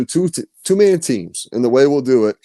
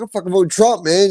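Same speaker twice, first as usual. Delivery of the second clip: going off; 9 semitones higher, 4 dB louder than usual. Retold or imitated. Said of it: "you know, going off".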